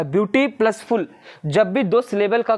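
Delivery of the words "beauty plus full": beauty plus full, split and slow; syllable split. Shown as a demonstration. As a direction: pull the two syllables apart slowly. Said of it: "टू syllable का".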